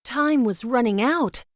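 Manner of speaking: ordinary delivery, nothing marked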